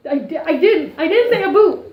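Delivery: high, loud spluttering protest; mock indignant denial. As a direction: stammer into an exasperated, comic denial. Acts out indignant denial of accent.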